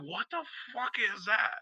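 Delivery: replaying the moment of finding the patch, startled; retold shock. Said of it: "and I'm like".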